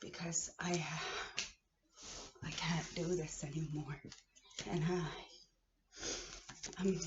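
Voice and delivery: drained whisper; worn-out whisper. Real, not acted. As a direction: breathe it out with nothing left. Breaking down after rant.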